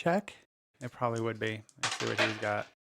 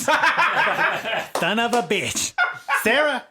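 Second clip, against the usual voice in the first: laughing; 7 semitones higher, 3 dB louder, much slower than usual. Real, not acted. Laughing curse at NPC.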